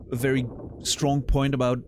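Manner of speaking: ordinary delivery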